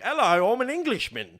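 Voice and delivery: loud, high hammed-up English accent. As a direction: announce yourself in a broad mock English accent. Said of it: "put on an English accent".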